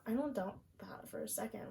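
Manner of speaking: breathy whispered "I don't doubt that". Real, not acted. Repeats the line, then whispers it.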